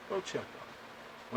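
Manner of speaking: hushed, slow whispered "Go check on it."; whispered repeat. Retold or imitated. Repeating the order to his brother, now in a slow whisper. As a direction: whisper "Go check on it." slowly, under the breath.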